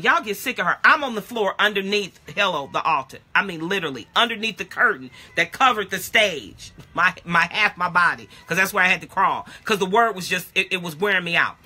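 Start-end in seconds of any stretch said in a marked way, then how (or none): none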